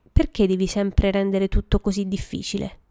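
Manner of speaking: ordinary delivery, nothing marked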